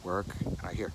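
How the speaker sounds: normal talking voice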